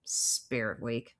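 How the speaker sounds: low, slow, soft mutter. A narrator's reading. Teacher grumbling to himself about the school event, muttered. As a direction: grumble it under your breath. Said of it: "I mutter".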